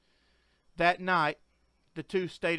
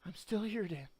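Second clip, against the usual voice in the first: softer, gentle comforting murmur; soft whisper; 6 dB quieter than usual. Acted. Wife in bed comforting her husband, soft whisper. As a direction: whisper it close to his ear. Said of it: "whispered softly".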